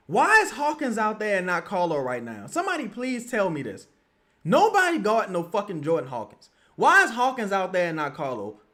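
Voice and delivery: 높고 큰 목소리로 계속 따지는 분노; angry rant. Real, not acted. Fan ranting about lineup choices.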